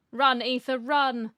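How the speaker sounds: loud playful call to flee; urgent mock shout. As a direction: shout it like urging someone to run. Urging game character to flee.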